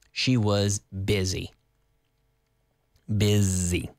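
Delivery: slow, careful, low-pitched teacher delivery; slow model pronunciation. Shown as a demonstration. Teacher repeating the phrase for learners, slow and careful. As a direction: say it slowly for learners.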